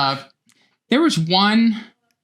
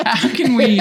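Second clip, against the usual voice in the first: "How can we?" repeated through real laughter, higher and louder; laughing; 10 semitones higher, 4 dB louder than usual. Real, not acted. Cracking up while asking a cohost how to film the splits. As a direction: laugh the question out loud.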